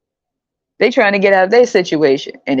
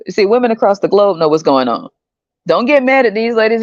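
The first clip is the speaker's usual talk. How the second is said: ordinary talk, nothing marked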